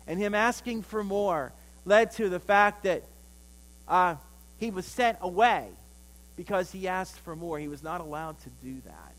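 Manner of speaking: ordinary delivery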